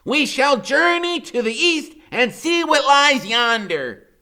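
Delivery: high, loud, slow knightly call; grand proclamation. A narrator's reading. Freak proposing a quest, high slow and loud. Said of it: "he says".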